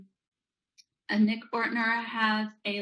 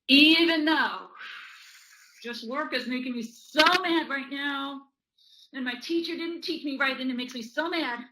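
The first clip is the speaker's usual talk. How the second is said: raised, emphatic mock frustration; acted anger; 4 semitones higher, 3 dB louder than usual. Demonstrating tapping as an angry child, exasperated venting. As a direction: complain like an angry pupil, pitched up. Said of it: "what that would look like".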